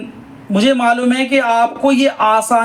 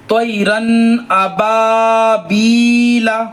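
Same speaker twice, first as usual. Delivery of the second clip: very slow, melodic tajweed reading; slow recitation; much slower than usual. Shown as a demonstration. Teacher modelling the verse, slow melodic chant. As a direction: chant it out, stretching each vowel. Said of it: "देखा आपने".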